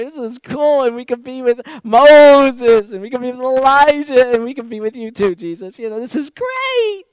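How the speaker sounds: loud, high, gushing ramble; rambling Peter. Acted. Peter gushing to Jesus about staying forever, loud and high. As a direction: gush on as if you never want to leave. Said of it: "And Peter, being Peter, has to say something".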